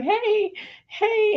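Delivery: very high-pitched, tentative hey; mock your own awkward, squeaky greeting; acted-out overly sweet hello. Awkward greeting to her son, retold.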